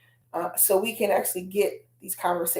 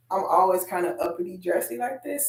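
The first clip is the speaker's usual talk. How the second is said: ordinary talk, nothing marked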